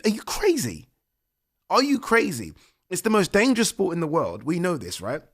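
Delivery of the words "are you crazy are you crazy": high, loud disbelief; incredulous yell. Retold or imitated. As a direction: shout it like the ref has lost his mind. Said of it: "I'm, I'm like".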